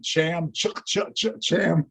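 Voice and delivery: "Cham" with stuttered "ch, ch, ch" between; comic sputtered "ch, ch, ch" before a botched "Cham"; mock failed pronunciation. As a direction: imitate getting stuck on "ch", landing on "Cham" as in chair. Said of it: "Truman going".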